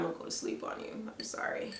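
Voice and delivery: soft, breathy apology whisper. Pretend. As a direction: whisper it like dozing off. Jokes about falling asleep.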